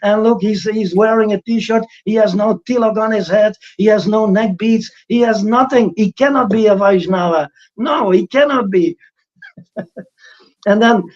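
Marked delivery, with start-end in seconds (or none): 0.0-9.0 higher, indignant mock outrage; hammed-up protest at a guru in a t-shirt; mock outraged purists. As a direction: play the scandalised critic flipping out. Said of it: "then they flip out and they say".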